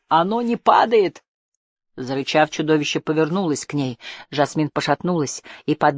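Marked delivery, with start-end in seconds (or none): 0.1-1.2 high, slow desperate exclamation; desperate cry. A narrator's reading. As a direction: cry it out in despair. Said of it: "в отчаянии воскликнула Жасмин".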